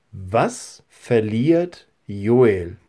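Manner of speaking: each word set apart slowly; very slow question. Real, not acted. Pastor gives children the first quiz question to remember, very slowly.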